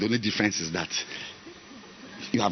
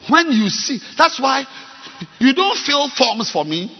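loud, high commanding preaching; shouting instructions from the pulpit; preaching at full force; 14 semitones higher, 10 dB louder than usual. Real, not acted. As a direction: bark orders at the congregation. Preaching over music.

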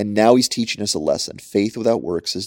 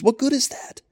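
incredulous. Acted. Scripture's rhetorical challenge.

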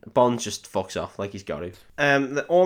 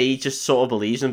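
ordinary talk, nothing marked

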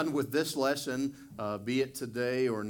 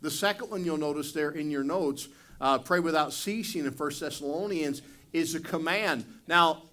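louder, higher, building preacher's fervour; fiery preaching; 6 semitones higher, 6 dB louder than usual. Real, not acted. Pastor pressing the congregation on prayer as command, fervent and loud. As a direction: drive the point home to the pews.

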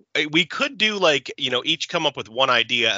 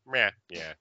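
resigned meh. Retold or imitated. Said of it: "that resignment, meh".

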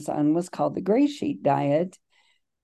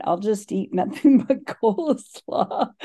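laughing through the words, pitched higher; 5 semitones higher than usual. Retold or imitated. Recovering overeater recalling own coleslaw diet plan, laughing. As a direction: say it breaking into an amused laugh.

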